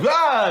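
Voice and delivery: high, loud mock scream; startled yelp. Startled shark bolting from the swimmer, high mock yelp.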